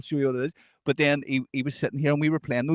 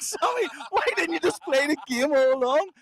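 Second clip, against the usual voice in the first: much higher, incredulous plea; exasperated disbelief; 12 semitones higher than usual. Retold or imitated. Pals scolding Sammy over darts, high incredulous. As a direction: throw up your hands at him.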